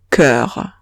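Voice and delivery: cœur with the rounded eu, no O heard; cœur said the French way. Shown as a demonstration. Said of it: "No pronuncio la O y digo".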